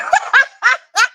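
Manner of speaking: high-pitched burst of laughter; real laugh, pitched well above speech. Real, not acted. Laughing about the next topic.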